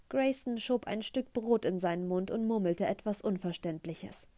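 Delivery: ordinary delivery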